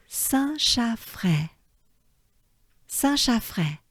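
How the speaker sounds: Saint-Chaffrey drawn out slowly; careful teacher's Saint-Chaffrey; slow French name. Shown as a demonstration. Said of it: "pronunciation drill".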